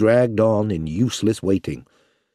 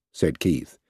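usual speaking voice

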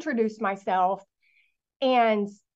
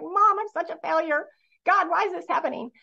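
higher, tearful plea to mom, lightly acted; retold crying; 7 semitones higher than usual. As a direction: mildly reenact sobbing on the phone in despair. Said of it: "I'm bawling".

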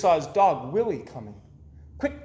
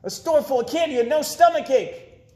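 put-on high, louder kid's shout; boy shouting, higher and louder; excited shout; 11 semitones higher, 6 dB louder than usual. A narrator's reading. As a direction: yell out the wish gleefully. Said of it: "shouted Eddie".

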